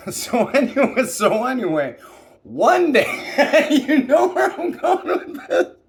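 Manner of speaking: can barely get it out for laughing. Real, not acted. Speaker cracking up mid-story.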